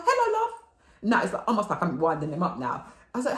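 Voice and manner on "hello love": much higher, louder sweet hello; sugary hello. Retold or imitated. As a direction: wind him up with sweetness. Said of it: "I go".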